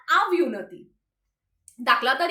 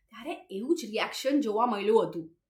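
ordinary talk

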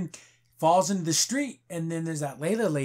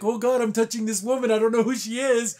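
flustered mock panic; 5 semitones higher than usual. He is grabbing her around the waist, mock flustered. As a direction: play his awkward alarm, hammed up a little. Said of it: "he's feeling like".

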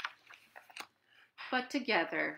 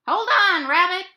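very high, loud shout; cried out; 12 semitones higher, 16 dB louder than usual. Acted. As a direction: yell it over the wind. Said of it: "cried Owl".

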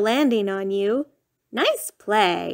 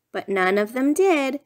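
usual speaking voice

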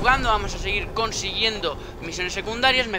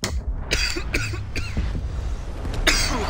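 coughing after water went down wrong; harsh coughing fit; choking cough; 11 semitones higher than usual. Real, not acted. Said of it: "se me ha ido por el otro lado". (tos atragantada)

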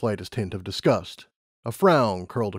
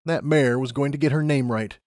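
plain reading voice

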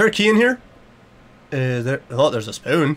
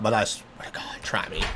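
low whispered warning; hushed threat; 9 semitones lower, 10 dB quieter than usual. Pretend. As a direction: whisper it as a threat under your breath. Muttered threat to the neighbors outside.

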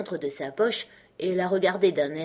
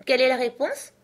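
giving up; 7 semitones higher, 5 dB louder than usual. Acted. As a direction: draw it out and give in. Alice gives up on the riddle.

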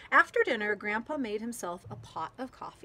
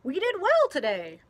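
a pleased grandfather, raised and louder; proud of the day's catch, call it out higher; grandpa's voice; 7 semitones higher, 10 dB louder than usual. A narrator's reading. Grandfather to Stina after fishing, pleased and loud. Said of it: "said Grandpa as he hung up the net".

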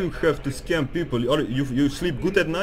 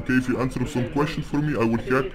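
processed voice-changer voice; 2 semitones lower, faster than usual. Real, not acted. Hacker confronting a scammer, voice changer. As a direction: speak through a voice changer. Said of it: "get off that voice changer".

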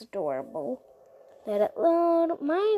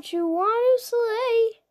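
singsong; 9 semitones higher, 6 dB louder, slower than usual. Real, not acted. Drawn-out singsong slay.

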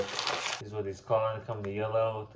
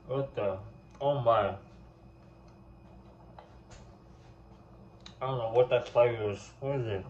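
talking while chewing; chewing candy. Real, not acted. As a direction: mouth partly full. Seen 3: Cheeks bulge and lips purse mid-chew throughout; candy eaten before.

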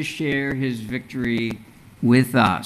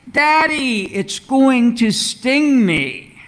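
high, louder, slow frightened cry; panicked little girl; 8 semitones higher, 4 dB louder than usual. A narrator's reading. Girl panics again at bee.

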